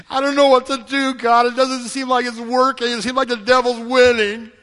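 high, loud complaint to God; hammed-up discouraged believer crying out; acted exasperated lament. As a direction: plead in mock despair, piling up the failures.

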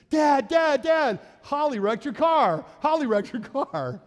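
imitating an excited son shouting high and loud; cheerful tattling yell. Son thundering downstairs to tell Dad about the wreck, cheerful high yelling. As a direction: gleefully yell the bad news, drawn out. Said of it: "Kind of cheerfully".

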